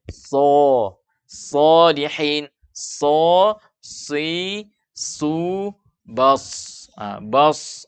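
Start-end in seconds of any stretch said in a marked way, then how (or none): 0.1-6.4 slow, heavy SO, "si", "su", "bas"; thick sod drill. Shown as a demonstration. Teacher drills sod with each vowel, thick sod. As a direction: draw out each sound, tongue back raised. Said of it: "lidah diangkat sehingga bunyinya".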